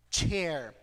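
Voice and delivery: chair with a clear ch, tongue on the palate. Shown as a demonstration. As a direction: start chair with a tch sound, not sh. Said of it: "You put your tongue on the top of the mouth". (chair com som de tch, língua no céu da boca)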